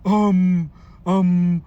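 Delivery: loud, drawn-out mocking um. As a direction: taunt with an exaggerated um.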